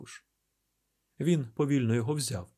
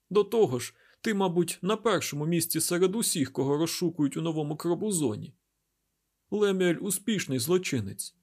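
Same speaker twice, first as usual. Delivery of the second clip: injured woman recounting events; 6 semitones higher than usual. A narrator's reading. Derkhan tells Isaac about waking up, higher-pitched recounting. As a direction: voice a woman urgently telling what happened.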